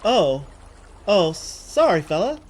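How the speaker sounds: startled awake and embarrassed; flustered apology. Acted. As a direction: caught off guard and apologizing. Startled character apologizing to sailor.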